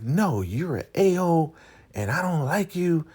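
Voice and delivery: high-pitched imitation of going off on him; mock telling-off. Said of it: "she's now going off on him".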